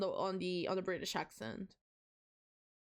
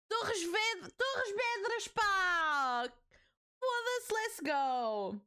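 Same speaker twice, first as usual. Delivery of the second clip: thrilled shout of "Torres Vedras, pá"; excited cheer; 9 semitones higher, much slower than usual. Real, not acted. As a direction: burst out with hometown pride. Excited about her home region.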